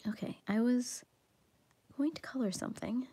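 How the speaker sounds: soft ASMR whisper. Real, not acted. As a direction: whisper it close to the mic. Whispering while coloring.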